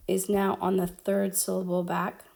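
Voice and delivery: ordinary talk, nothing marked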